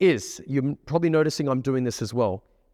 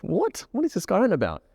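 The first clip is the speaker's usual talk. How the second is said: high-pitched, puzzled reaction; baffled; 6 semitones higher than usual. Retold or imitated. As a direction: react as if he makes no sense. Imagined baffled reaction to awkward line.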